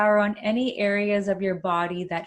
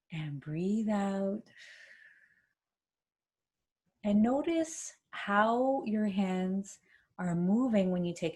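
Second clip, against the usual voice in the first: soothing massage murmur; 4 dB quieter than usual. Acted. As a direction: ease them gently into each breath. Leads a breathing exercise.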